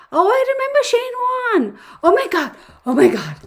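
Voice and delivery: high, loud burst of delight at a remembered name; excited recognition. Real, not acted. Streamer thrilled as a viewer asks about Shane Warne, excited.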